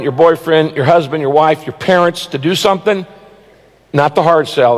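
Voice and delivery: ordinary delivery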